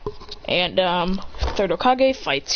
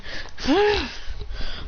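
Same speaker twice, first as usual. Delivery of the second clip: high, acted strained breath; short effortful gasp, pitched up; imitated effort noise; 10 semitones higher, 3 dB quieter than usual. Fighter pulling wire with his mouth, strained gasp.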